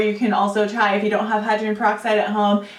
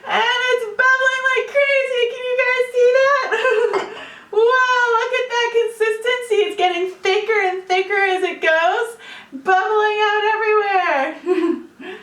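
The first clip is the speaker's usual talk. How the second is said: much higher, thrilled and laughing; exaggerated cartoon intonation; 12 semitones higher than usual. Real, not acted. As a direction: squeal with delight at the foam. Yeast foam erupts on camera.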